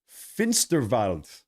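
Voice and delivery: Finsterwald said slowly the German way, W as V; "Finsterwald" with German "-valt" ending; German pronunciation. Shown as a demonstration. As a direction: draw out "Finsterwald" in German, with a V sound for the W. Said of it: "That's how they say it in German".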